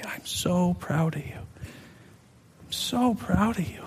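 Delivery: soft, tender reassurance; gentle, sweet. Retold or imitated. The Lord comforting his repentant son, softly and tenderly. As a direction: hush it like a loving father. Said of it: "so gently, so sweetly just began to say".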